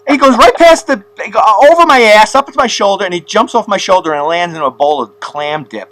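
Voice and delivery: high and loud, fast-paced storytelling; excited. Real, not acted. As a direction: tell it all worked up.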